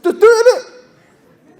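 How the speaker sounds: hammed-up lad voice, cracking up; mock street talk with a laugh; street slang. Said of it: "that's how he really speaks".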